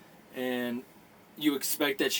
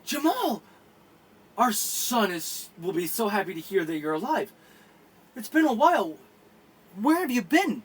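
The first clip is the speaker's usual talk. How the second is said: higher, surprised female voice; surprised sister; 6 semitones higher than usual. Acted. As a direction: greet your lost brother in disbelief. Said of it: "Janet looks at Jamal and goes".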